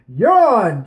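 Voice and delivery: loud, full shout of the name; a captain shouts for his king. Acted. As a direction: bellow it across the crowd. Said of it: "shouted Left Hand Lucas Codd".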